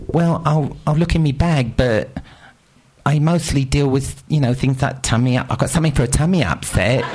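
high, prim nurse voice with regional lilt; mock British nurse. Nurse's reply imitated.

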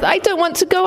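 put-on a high child's blurt, full of feeling. A narrator's reading. Little girl protests to Mum, high and pleading. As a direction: push it out as a child would. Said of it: "I blurted out with as much feeling".

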